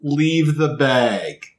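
very slow, every word stretched; drawn-out order. Acted. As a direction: give the order slowly and pointedly. In-character order to first officer.

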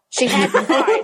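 words broken by real giggles; laughing. Real, not acted. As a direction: try to read through the giggles. Reader laughing mid-sentence.

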